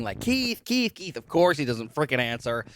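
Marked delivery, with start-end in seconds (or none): 0.2-1.2 high, restrained mock yell. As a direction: imitate her calling for him, held back. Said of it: "then she starts yelling".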